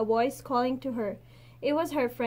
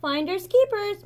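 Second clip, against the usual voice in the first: a high, louder parting taunt; calling over shoulder; 8 semitones higher, 6 dB louder than usual. A narrator's reading. Mei Mei running home, calling back high and louder. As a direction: fling it back while running. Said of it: "Mei Mei called over her shoulder".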